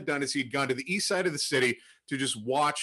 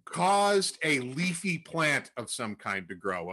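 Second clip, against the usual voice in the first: ordinary talk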